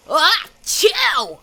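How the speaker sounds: a big high sudden achoo; a cartoon dinosaur's explosive sneeze; loud sneeze. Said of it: "immediately sneezed".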